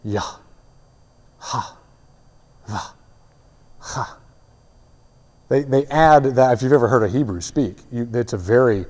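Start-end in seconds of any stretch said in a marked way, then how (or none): 0.0-4.2 Yah, ha, va, ha said as soft breaths on an exhale; breathed name. Shown as a demonstration. Preacher shows the name as the sound of breath: soft breathy syllables. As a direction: breathe each syllable almost without voice. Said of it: "not a pronunciation so much as a breath".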